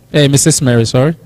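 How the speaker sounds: ordinary talk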